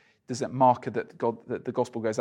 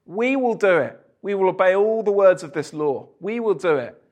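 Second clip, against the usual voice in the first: higher, eager vow of the crowd; the people's vow; 6 semitones higher, 5 dB louder than usual. Retold or imitated. Preacher voicing the people at Sinai, raised eager vow. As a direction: voice the Israelites promising a touch grandly. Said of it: "It's different".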